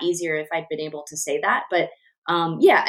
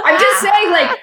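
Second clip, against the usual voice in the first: laughing; 11 semitones higher, 8 dB louder than usual. Real, not acted. Laughing defence.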